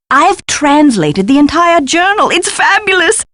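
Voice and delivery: delighted announcement. Acted. Scholar announcing the translated journal to helper, thrilled.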